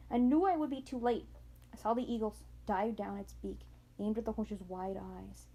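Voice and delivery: ordinary delivery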